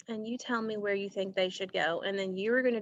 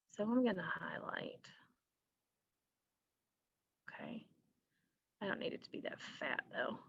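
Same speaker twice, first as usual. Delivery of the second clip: whispered to herself, much softer; muttered aside; 3 semitones lower, 12 dB quieter than usual. Real, not acted. Teacher mutters to herself while highlighting, whispered. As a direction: breathe it under your breath while fiddling with the tool.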